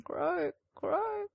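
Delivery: high, rising crow caw; raven cawing. Acted.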